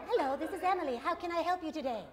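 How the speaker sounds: a touch of chirpy helpfulness; sweet phone operator. Retold or imitated. Emily from Bell Canada greeting a caller, soft and sweet. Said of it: "like my dental hygienist".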